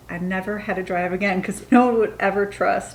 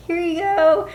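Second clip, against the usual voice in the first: very high, louder sing-out; fake-casual handover; 10 semitones higher, 5 dB louder than usual. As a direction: act innocent, pitched way up. Nervously hands over the fake ID.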